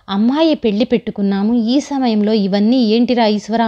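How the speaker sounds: plain reading voice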